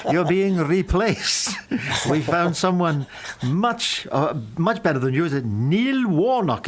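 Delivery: slow, swooping comic Welsh lilt; hammed-up Welsh accent, breaking the news. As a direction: draw it out in a high sing-song Welsh voice. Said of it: "I'll do the Welsh accent 'cause it's funny".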